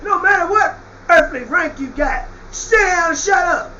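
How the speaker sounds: normal talking voice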